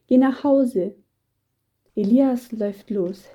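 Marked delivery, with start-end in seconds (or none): 0.1-0.9 higher, louder narrated order; insistent call. A narrator's reading. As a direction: call it out to send him off firmly. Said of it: "ruft Petrus und gibt Elias einen kräftigen Stoß".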